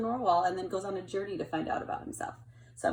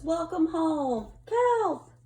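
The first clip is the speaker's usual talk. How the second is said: slow, rising high and louder on Kelp; welcome banner; 8 semitones higher, 6 dB louder, much slower than usual. Acted. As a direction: greet him with open arms. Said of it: "The sign says".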